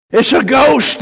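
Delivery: high, loud cry of fright; frightened cry. Acted. Disciples seeing a ghost, crying out in fear. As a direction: shout it like terrified fishermen. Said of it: "and cried out in fear".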